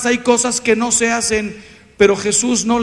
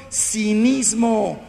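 stretched out slow, a single word; slow emphatic label; much slower than usual. Real, not acted. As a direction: draw the word out slowly to name it. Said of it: "labels it slowly".

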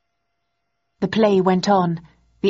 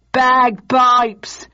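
raised, loud interjection; heckler calling out; 6 semitones higher, 5 dB louder than usual. A narrator's reading. Another audience member suggesting instruments, calling out. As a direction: call it out from the crowd. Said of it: "said another".

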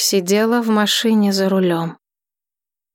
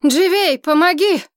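calling for help; 9 semitones higher than usual. Acted. Friends call for help.

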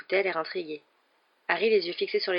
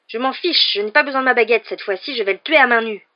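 high, loud furious threat; raging; 5 semitones higher, 10 dB louder than usual. A narrator's reading. Ron swears to fight Malfoy bare-handed, loud rage. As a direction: shout the threat, barely restrained.

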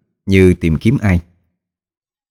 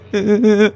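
high, pulsing hu-hu sobs; acted little-boy boohoo; child's sobbing. A narrator's reading. Drowned boy cries to Hiệp, sobbing. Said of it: "thì khóc òa lên".

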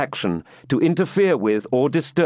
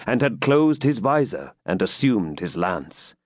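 usual speaking voice

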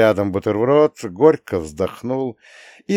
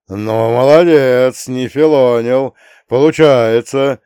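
slow, loud, drawn-out approval; hearty praise; 3 semitones higher, 8 dB louder, slower than usual. A narrator's reading. Veteran praising the boy's guitar playing, slow hearty praise. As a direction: praise generously, stretching each word. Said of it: "похвалил ветеран".

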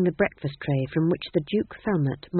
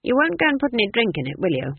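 flat; 6 semitones higher, 4 dB louder, faster than usual. Acted. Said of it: "Granny said flatly".

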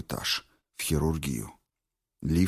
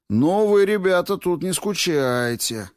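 high, loud start dropping as it slows; amazed exclamation; 13 semitones higher, 6 dB louder than usual. A narrator's reading. Rescuer from intensive care sees the lifting scene, loud amazed remark. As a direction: call out in open-mouthed amazement.

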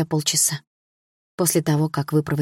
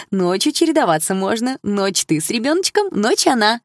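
put-on higher sugary chirp of an elderly lady; chirping old woman; 8 semitones higher than usual. Elderly relative to the daughter, sweet chirping. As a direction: coo it sweetly, a little spiteful. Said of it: "прощебетала она".

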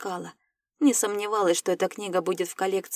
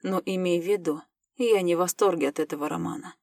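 usual speaking voice

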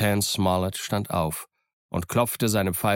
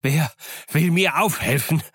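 higher, louder furious bellow; 8 semitones higher, 5 dB louder than usual. Acted. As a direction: roar for someone to help him up. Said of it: "brüllte er".

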